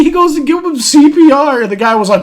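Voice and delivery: usual speaking voice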